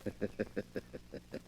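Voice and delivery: soft, breathy villain's chuckle under his breath; sinister chuckle. Acted.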